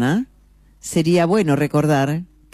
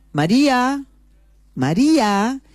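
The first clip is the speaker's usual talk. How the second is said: calling out; 9 semitones higher, slower than usual. Acted. Husband calling wife, walking closer.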